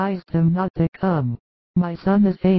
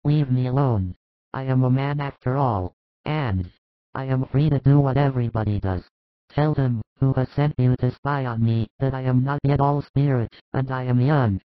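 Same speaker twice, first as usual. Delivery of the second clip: put-on defiant drunk apostle, lower voice; sullen Judas; 5 semitones lower than usual. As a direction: lower voiced young man pushing her off.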